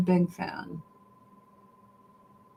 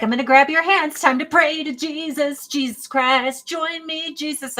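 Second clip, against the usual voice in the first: higher-pitched mock pious call to prayer; praying mother-in-law; 6 semitones higher than usual. Mother-in-law starting prayer at Thanksgiving, imitated higher voice. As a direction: mimic an insistent prayer leader, pitched up.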